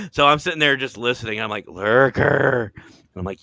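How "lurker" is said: a louder mock call of the name; a retold call, louder; mild yell. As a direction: call out to rouse him. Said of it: "yelling at him".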